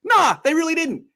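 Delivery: high, loud emphatic protest; excited protest. Real, not acted. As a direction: shout it out in disbelief. Emphatic disagreement about Titans.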